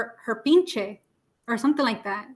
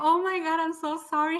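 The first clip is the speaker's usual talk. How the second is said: higher-pitched imitated apology; retold mortified apology; 5 semitones higher than usual. Doctor learning she said a bad word, retold mortified apology.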